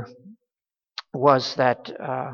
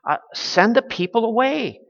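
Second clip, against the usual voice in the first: higher, exasperated complaint to Jesus; frustrated disciples; 6 semitones higher than usual. Retold or imitated. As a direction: voice the tired disciples' irritation. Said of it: "you can hear the, that frustration".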